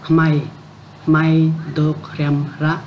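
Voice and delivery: slow, careful syllable by syllable "may tôi không rap"; read slowly. Shown as a demonstration. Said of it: "các bạn đọc chậm lại nhé".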